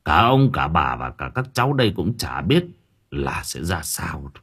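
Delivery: low, softened voice of an elder; gentle old man. A narrator's reading. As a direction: counsel the family gently and gravely. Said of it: "Ông cụ Thân nhẹ nhàng bảo".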